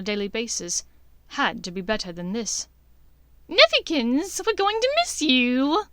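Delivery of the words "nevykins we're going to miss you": high, loud sing-song taunt; sing-song Bellatrix. A narrator's reading. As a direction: croon it mockingly like a mad aunt. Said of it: "Bellatrix sing-songed in his direction".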